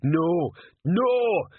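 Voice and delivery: high-pitched, emphatic troll shout; troll yelling. A narrator's reading. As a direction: yell it in troll voice, pitch leaping up. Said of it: "yelled Detritus".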